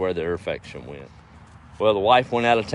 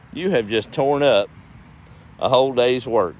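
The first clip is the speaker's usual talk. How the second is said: scolding; 5 semitones higher, 5 dB louder than usual. Real, not acted. Scolds dog for torn-up planting.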